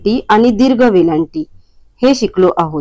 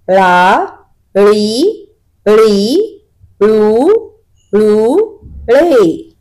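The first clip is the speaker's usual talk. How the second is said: put-on slow, clearly spaced syllables for young learners; syllable drill recitation; 3 semitones higher, slower than usual. Shown as a demonstration. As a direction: sound out each syllable distinctly, one by one, for the children. Teacher drills letter syllables aloud. (मुलांसाठी प्रत्येक अक्षर स्पष्ट, थांबत थांबत उच्चारणे)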